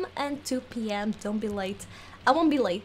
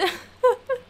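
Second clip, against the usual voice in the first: laugh; 12 semitones higher, 6 dB louder than usual. Real, not acted. Frustrated gamer laughs at herself.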